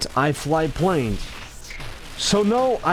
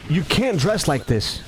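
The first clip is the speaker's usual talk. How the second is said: usual speaking voice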